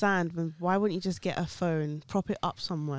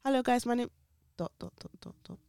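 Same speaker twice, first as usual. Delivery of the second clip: mock halting speech; 2 semitones lower, faster than usual. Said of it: "you're talking with ellipses".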